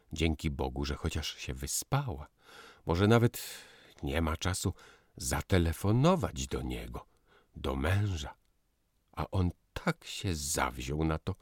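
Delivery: usual speaking voice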